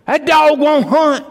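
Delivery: high, booming voice of God; God's greatest voice. Retold or imitated. Preacher voicing God to the lazy believers, booming. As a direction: thunder it out as God on his throne. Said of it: "God will say in his greatest voice".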